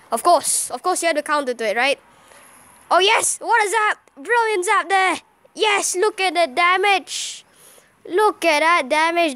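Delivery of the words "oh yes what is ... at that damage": higher, drawn-out exclamations of triumph; excited cheer. Real, not acted. Player cheering his zap's damage, excited. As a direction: celebrate a great hit.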